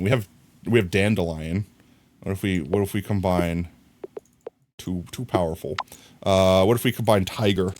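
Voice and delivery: ordinary delivery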